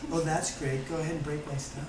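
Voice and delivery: soft, mock-serene laughing sarcasm; fake serenity. Fake-enlightened person letting someone break their stuff, soft mock calm. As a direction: coo it with false calm. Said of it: "doesn't get mad no matter what you do".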